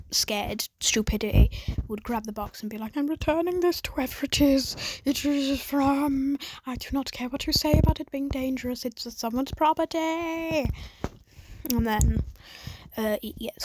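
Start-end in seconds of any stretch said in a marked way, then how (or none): 3.0-10.7 higher, louder mock-righteous voice; hammed-up prim insistence, pitched up; mock indignant Itadori. Itadori voiced in scared retelling.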